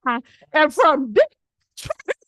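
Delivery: very slow, high, loud insistence; slow insistent. Retold or imitated. She repeats her origin to the stranger, slow, high and exasperated. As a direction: stretch each word out in exasperation. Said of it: "I'm like".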